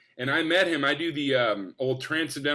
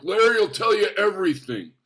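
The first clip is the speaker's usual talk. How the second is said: higher, louder imitated brush-off; Super Dave; 5 semitones higher, 6 dB louder than usual. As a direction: voice a veteran comic waving off questions. Acting Super Dave's brush-off.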